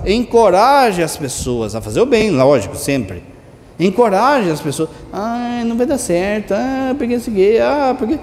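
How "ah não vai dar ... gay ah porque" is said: mock whining. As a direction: imitate a defeatist moaning "ah". Mimics discouraging people's excuses.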